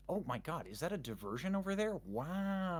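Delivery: hammed-up fake surprise; mock distraction. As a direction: point away to change the subject.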